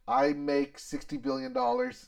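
boasting like a rich executive; smug CEO voice. Retold or imitated. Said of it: "Yes, this is my".

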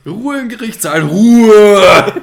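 lauter, gespielter Ruf der Richterin; brüllen wie eine wütende Richterin; Richterin She-Hulk. Pretend. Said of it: "Das war jetzt gut getroffen von mir". (laut rufende Richterin)

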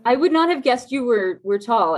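ordinary delivery

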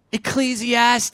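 high, drawn-out show-announcer reveal; grand announcement. Acted. Preacher revealing his book after a drum roll, grand announcement. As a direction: stretch it out high like a big reveal.

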